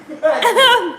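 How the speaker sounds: high, loud mock of her command; imitating her scolding. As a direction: mimic the wife calling him back. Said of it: "She said".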